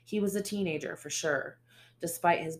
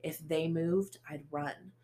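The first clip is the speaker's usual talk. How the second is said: usual speaking voice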